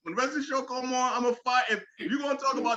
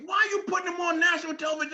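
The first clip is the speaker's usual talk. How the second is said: fast, higher, fed-up shout; exasperated shout; 8 semitones higher, faster than usual. Real, not acted. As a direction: demand it of the network. Ranting at network schedule.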